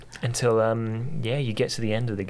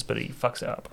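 normal talking voice

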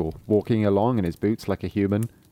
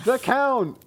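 shouted answer; 13 semitones higher than usual. Acted. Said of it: "they all shouted".

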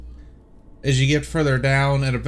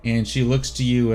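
normal talking voice

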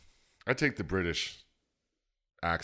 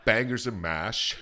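slow, drawn-out mock British; hammed-up British, higher pitched; British accent; 4 semitones higher, slower than usual. Shown as a demonstration. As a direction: relish it in a fake British accent. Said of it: "talk British all the time".

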